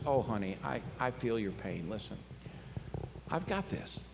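soft, low, tender reassurance; soothing voice, like a pat on the back; gentle comfort. Retold or imitated. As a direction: console her quietly, pausing. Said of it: "just needs a pat on the back".

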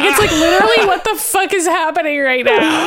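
giggling, bewildered rant; laughing exasperation. Real, not acted. Host baffled by the cartoon's ending, laughing exasperation. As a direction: laugh through the disbelief.

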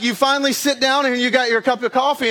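normal talking voice

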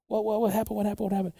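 high, soft flustered repeating; hushed mock panic; startled reply. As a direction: imitate a confused young driver. Retold startled driver answering.

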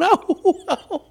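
high yelped ow, ow, laughing through it; imitating a kid yelping under a whipping; mock cries of pain. Mimicking himself as a boy getting whipped, high laughing yelps.

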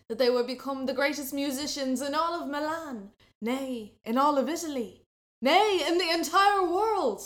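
rising to a grand proclamation; building oath. A narrator's reading. Two boys vowing greatness, building to a shout.

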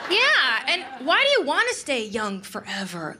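ordinary delivery

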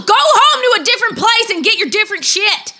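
loud exasperated yell; shouting. Acted. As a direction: yell it out in frustration. Frustrated outburst at others.

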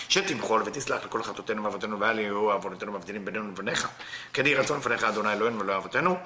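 rapid, low murmured Hebrew prayer; davening at speed; fast prayer recitation. Acted. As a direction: rattle off the prayer quickly and low. Opening prayer recited before lesson.

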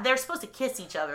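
ordinary delivery

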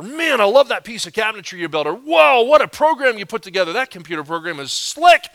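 loud, impressed coworker; gushing praise. Acted. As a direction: heap admiration on a colleague, building to a high "whoa". Acted coworkers heaping praise.

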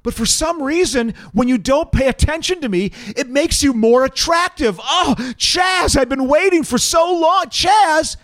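fan calling out desperately; swooning woman. Acted. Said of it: "Here's my other".

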